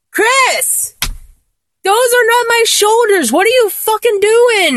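high, indignant outburst; high-pitched, startled objection; indignant protest. Acted. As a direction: snap at a friend whose hands wander. Protests at where the massage wanders.

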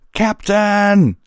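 high, loud, drawn-out call; distant yell. A narrator's reading. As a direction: holler for the captain from afar. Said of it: "The distant yell".

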